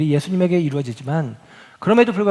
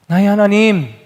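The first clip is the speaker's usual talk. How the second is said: loud, higher outcry; anguished cry; 4 semitones higher, 8 dB louder than usual. Acted. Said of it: "절규와 같은 외침". (고통스러운 외침)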